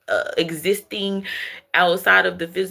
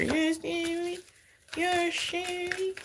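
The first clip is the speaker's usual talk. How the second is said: playful aside; 10 semitones higher, 9 dB quieter than usual. Real, not acted. High teasing aside.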